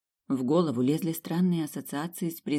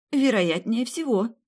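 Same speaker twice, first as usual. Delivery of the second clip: breathless; 8 semitones higher, 4 dB louder, slower than usual. Acted. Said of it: "еле выдохнула".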